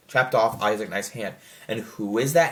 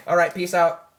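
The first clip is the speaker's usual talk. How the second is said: mock breezy exit; 6 semitones higher, 4 dB louder than usual. Mock character exit line.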